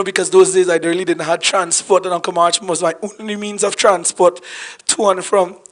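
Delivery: ordinary talk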